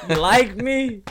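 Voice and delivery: slow, loud, laughing question; laughing tease. Pretend. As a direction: tease him while cracking up. Teasing the friend, laughing.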